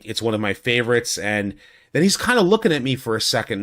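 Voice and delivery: ordinary talk, nothing marked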